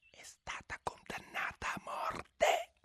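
slow, soft secret whisper. A narrator's reading. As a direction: breathe it into her ear. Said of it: "bisbigliò".

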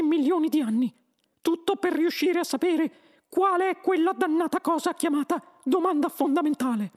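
high, loud squeaky explaining voice; Benji. A narrator's reading. Mouse explains the plan to the Earthman, squeaky voice. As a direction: lay it out in a mouse voice. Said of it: "il topo Benji".

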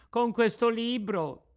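high, slow, meek reply; timid puppet. A narrator's reading. Pinocchio hands the book to the carabiniere, meek. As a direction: shows the book shyly.

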